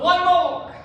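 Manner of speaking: rally them through the last one; energetic push. Real, not acted. Coach pushing final rep.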